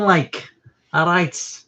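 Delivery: a quick Scouse-style "all right"; Liverpool accent. Shown as a demonstration. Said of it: "Do you know what a Liverpool accent is?".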